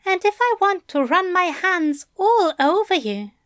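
Aziraphale. Acted. Angel to bound Crowley about touching him, fondly teasing. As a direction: tease him lightly, voice lifted.

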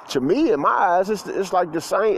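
normal talking voice

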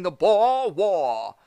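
slow, hammy Boston "Boer War"; Boston accent. Pretend. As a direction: say "Boer War" as "pah waw", Boston-style. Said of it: "It's a terrible Boston accent".

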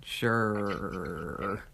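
long drawn-out doubting "sure"; low, teasing fake agreement; skeptical agreement. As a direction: stretch it out in disbelief. Teasing disbelief at a denial.

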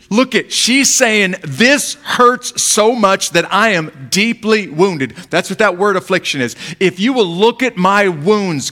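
impassioned sermon delivery; preaching. Acted. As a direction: press each word on the listeners. Keeps preaching the passage.

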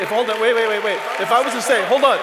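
loud, high, fired-up preacher calling to the crowd; preaching at full force. Acted. Pastor rousing the Easter congregation to shout, booming. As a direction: whip the church into cheering.

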